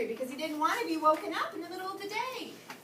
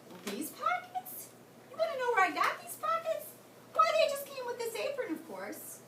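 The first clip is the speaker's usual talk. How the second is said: high cheerful man; 9 semitones higher, 3 dB louder than usual. Acted.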